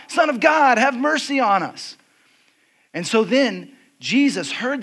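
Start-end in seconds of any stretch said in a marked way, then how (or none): 0.1-2.0 louder, much higher insistent call; retold shout. Beggars who wouldn't stop calling to Jesus, retold shout. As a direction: cry out harder, refusing to be silenced. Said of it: "were shouting out to Jesus".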